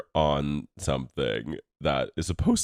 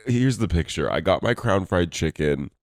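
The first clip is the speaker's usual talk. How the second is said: ordinary delivery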